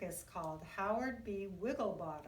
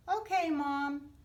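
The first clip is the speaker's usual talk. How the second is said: put-on a higher, louder cheerful kid reply; the boy answers his mother when she asks him to tidy up; child answering; 5 semitones higher, 7 dB louder than usual. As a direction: answer Mom brightly like a good boy.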